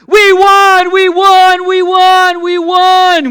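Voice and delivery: high, loud triumphant shouts; shouting with teen excitement; excited yelling. Retold or imitated. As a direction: yell it like you just won a million. Kids shouting they won.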